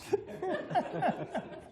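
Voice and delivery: soft, very high giggle; high soft laugh. Real, not acted. Giggling softly after saying yes to the lady. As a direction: let a high giggle trail off.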